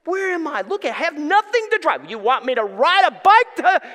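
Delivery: high, mounting mock outburst, loud by the end; retold anger at God. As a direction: act out an upset complaint to God. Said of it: "I was really angry with God".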